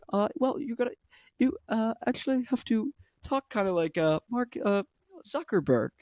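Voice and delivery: hesitant tech-boss caricature; Mark Zuckerberg impression. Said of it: "That's how he sounds".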